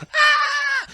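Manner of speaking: long, very high scream; neighbor's scream. Acted. Neighbor looks at the computer, screaming in mock horror. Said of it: "my neighbor's like".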